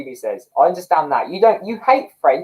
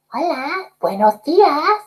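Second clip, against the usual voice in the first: much higher, slow, disguised prank-call voice; put-on voice; 12 semitones higher, much slower than usual. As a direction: fake a stranger's voice on the phone. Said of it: "I'd put on a voice".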